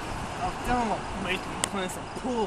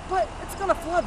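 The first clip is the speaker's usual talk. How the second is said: higher, worried women's voice; mocking the women; 11 semitones higher, 5 dB louder than usual. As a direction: mimic them warning him. Women voiced in his story.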